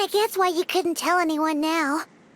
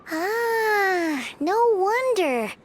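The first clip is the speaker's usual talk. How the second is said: normal talking voice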